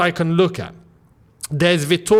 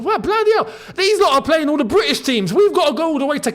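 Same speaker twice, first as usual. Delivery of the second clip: exasperated; 8 semitones higher, faster than usual. Real, not acted. Exasperated about easy draw.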